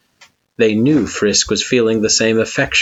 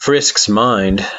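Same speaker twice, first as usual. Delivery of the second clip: usual speaking voice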